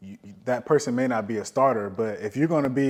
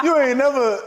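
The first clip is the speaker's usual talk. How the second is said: put-on much higher and louder, hyped teasing girl voice; teasing Instagram girl; 14 semitones higher, 7 dB louder than usual. Host voices a girl teasing the player's Instagram posts, high laughing hype. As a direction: hype her up like a friend in the comments.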